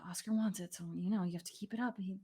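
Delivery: mumbled, whispery griping to himself; grumbling. Acted. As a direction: grumble under your breath, quick and grudging.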